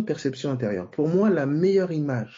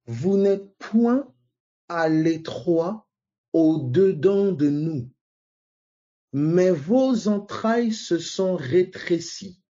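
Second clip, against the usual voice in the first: slow, raised solemn reading of the verse; scripture reading; 5 semitones higher, much slower than usual. Acted. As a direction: lis le verset lentement, voix haute et solennelle. (lecture biblique lente et solennelle)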